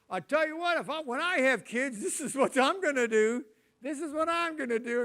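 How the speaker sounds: loud, high cocky boast; cocky vow. Retold or imitated. Childless person boasting how they'll parent, loud and cocky. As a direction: play the smug childless person who knows it all. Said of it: "They say this".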